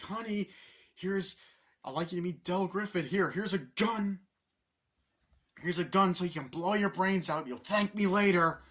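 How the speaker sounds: high, loud mock-cheerful presentation; sarcastic introduction. Man introduces roommate to his wife, loud sarcastic pitch.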